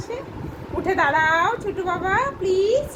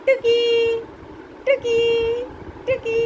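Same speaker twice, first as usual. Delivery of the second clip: playful peekaboo; 5 semitones higher, much slower than usual. Real, not acted. Peekaboo game with toddler.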